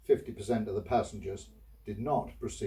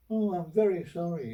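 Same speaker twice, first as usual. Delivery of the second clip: higher mock-polite shopkeeper voice; apologetic shopkeeper; 7 semitones higher, 3 dB louder than usual. Shopkeeper apologising to the customer, higher, meek voice. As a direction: play the shopkeeper grovelling a little, pitched up. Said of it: "And he said".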